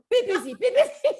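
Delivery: very high, loud mock Vietnamese accent. Nail technician warning coworkers, high imitated Vietnamese accent with laughter. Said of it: "I love her accent".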